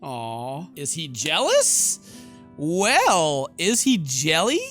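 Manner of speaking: loud, drawn-out mock taunt; sing-out teasing with a big rise at the end; playful teasing. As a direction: tease him loudly, stretching the words out. Player teasing a jealous character.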